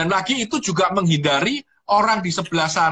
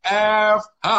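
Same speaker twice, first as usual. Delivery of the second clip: puzzling it out; 3 semitones higher, slower than usual. Retold or imitated. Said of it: "saya lagi mikir".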